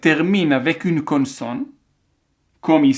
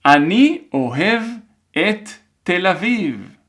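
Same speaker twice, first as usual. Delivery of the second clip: careful dictation in separated chunks; measured phrase-by-phrase dictation; 4 semitones higher than usual. Shown as a demonstration. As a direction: read it out for learners to write, pausing between chunks. Troisième phrase.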